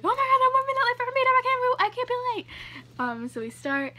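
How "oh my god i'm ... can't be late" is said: high, loud, fast panicked babble; mock panic. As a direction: rattle it off like you're late for everything. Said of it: "I'm like".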